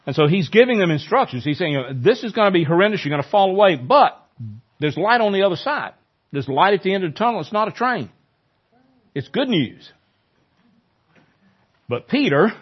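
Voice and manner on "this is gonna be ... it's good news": raised, brisk reassurance of hope; lively encouraging Jesus. Retold or imitated. Jesus encouraging his disciples before the night, raised and eager. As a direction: urge the frightened men to see the light ahead.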